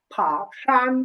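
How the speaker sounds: ফা, শান as two separate syllables, শান ending in n; pronunciation demo. Shown as a demonstration. Teacher correcting the second syllable, pronunciation demo of ফা, শান. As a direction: model ফা, pause, then শান. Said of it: "এটা হবে".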